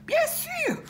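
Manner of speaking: very high, bright cub squeal; baby bear. Acted. Bébé ours answers Boucle d'Or, high baby-bear voice. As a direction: chirp it way up high. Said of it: "dit le bébé ours".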